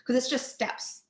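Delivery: usual speaking voice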